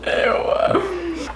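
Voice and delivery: high drawn-out disgusted ew; grossed out. Real, not acted. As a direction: squeal of disgust. Disgusted by a pickle.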